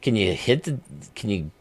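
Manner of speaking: ordinary delivery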